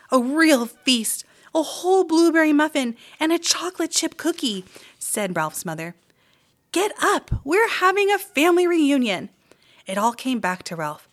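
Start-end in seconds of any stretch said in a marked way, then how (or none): 0.1-4.7 high, happy mother mouse; mother mouse delighted by the feast. A narrator's reading. 6.7-9.3 high, bright voiced mother mouse; mother mouse calling Ralph to the reunion; happy mother urging. A narrator's reading.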